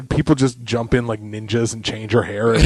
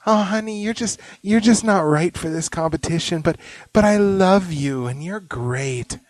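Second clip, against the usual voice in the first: [name] impression; 8 semitones higher than usual. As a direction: gush like a tipsy judge. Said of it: "telling Simon".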